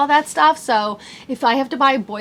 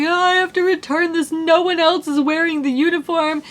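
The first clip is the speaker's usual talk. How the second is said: put-on higher, petulant child whine; mock bratty kid complaint; whiny kid; 7 semitones higher than usual. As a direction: play your younger, upset self. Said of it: "I was like".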